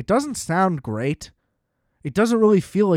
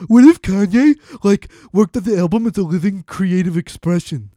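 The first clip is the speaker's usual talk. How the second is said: higher, much louder sneering mock voice of a tech boss; mocking Jack Dorsey; 4 semitones higher, 9 dB louder than usual. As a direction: parody his naive idea with loud scorn. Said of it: "Fucking Jack Dorsey, that dumb motherfucker".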